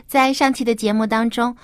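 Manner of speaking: plain reading voice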